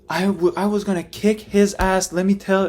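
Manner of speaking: imitating sister, high-pitched and theatrical; sister's dramatic story voice. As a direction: ham it up like the sister telling a story. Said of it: "She's very, like".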